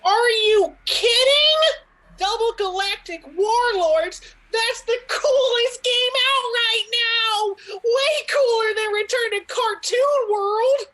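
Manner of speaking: put-on a kid who just got his dream gift; thrilled shout. Boy thrilled by the game.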